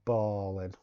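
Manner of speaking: American accent. Shown as a demonstration. Showing the American way after the British one, a fake American accent. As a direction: fake American "balling", rhymes with calling. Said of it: "fake American accent".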